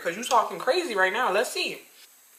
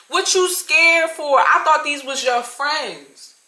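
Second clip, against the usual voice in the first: mocking taunt; 7 semitones higher, 3 dB louder, slower than usual. Taunting the fleeing woman.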